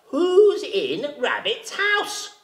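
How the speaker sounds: slow, drawn-out jackal call. A narrator's reading. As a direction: call out slowly at the door. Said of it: "Jackal went up and he called out".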